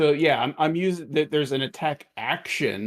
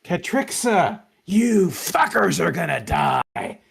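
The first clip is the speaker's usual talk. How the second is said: loud, higher-pitched villain's scream; enraged scream; 4 semitones higher, 5 dB louder than usual. Acted. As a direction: scream a death threat in disbelieving rage. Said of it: "and screams out".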